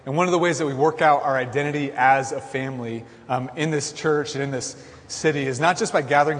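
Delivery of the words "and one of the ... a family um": usual speaking voice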